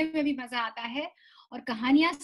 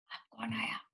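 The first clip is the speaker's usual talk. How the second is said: whisper; 4 semitones lower, 12 dB quieter, faster than usual. Acted. Teacher hushes kids mid-rhyme.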